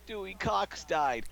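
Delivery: high, slow, mock-tearful delivery; imitating crying mom. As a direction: play the weepy mom springing the prank. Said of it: "she's crying and she's blubbering".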